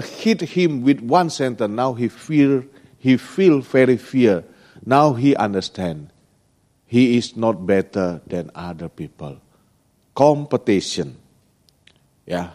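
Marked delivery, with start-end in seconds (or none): none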